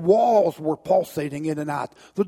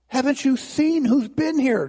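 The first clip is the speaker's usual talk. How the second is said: distraught man pleading; 6 semitones higher than usual. Acted. Distraught man questions Jesus.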